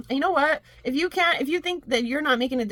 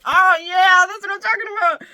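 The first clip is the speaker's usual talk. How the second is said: excited cheer; 8 semitones higher, 8 dB louder than usual. Real, not acted.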